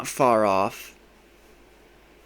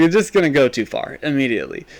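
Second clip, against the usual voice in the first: ordinary talk, nothing marked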